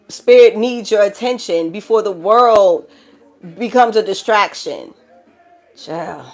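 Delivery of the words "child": normal talking voice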